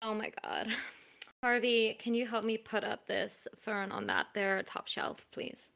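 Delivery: bitchy bored sneer. Acted. The bitchy couple.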